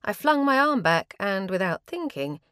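plain reading voice

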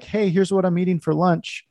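perky, mocking the old lunch posts; mock cheery poster.

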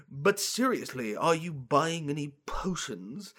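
slow potion seller staying in character; drawn-out, theatrical shopkeeper; committed potion salesman. Acted. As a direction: press on in the hammy salesman voice. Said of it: "you commit to the bit".

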